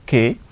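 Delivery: K said as the syllable "kay"; teacher sounding out K as "kay"; syllable demo. Shown as a demonstration. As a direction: blend K into one pure "keh/kay" syllable. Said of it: "ka plus A".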